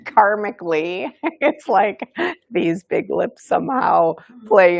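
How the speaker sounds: amused at herself, giggles breaking in; real laughing. Real, not acted. Amused by own karmic joke.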